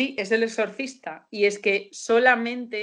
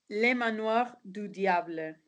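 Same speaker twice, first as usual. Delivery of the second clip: French pronunciation, slow and careful; French accent; slower than usual. Shown as a demonstration. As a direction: say it like a native French speaker. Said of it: "me voy a poner francesa".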